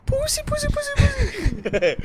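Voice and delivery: high, drawn-out teasing squeal through laughter; squeaky laughing taunt; mocking squeal. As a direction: taunt it like a scared kid. Mocking the scared spirit.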